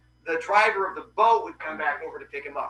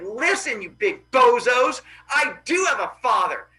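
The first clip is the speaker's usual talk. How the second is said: put-on defiant kid; 6 semitones higher, 6 dB louder than usual. Boy confronting the kids at school, loud and defiant. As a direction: puff up and call out the bullies. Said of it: "He's like".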